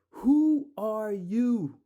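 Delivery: loud, slow, outraged challenge; indignant shout. Real, not acted. Ranting at the manager, an outraged shout. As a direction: demand it, furious and drawn out.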